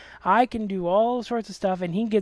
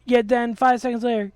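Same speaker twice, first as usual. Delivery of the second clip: normal talking voice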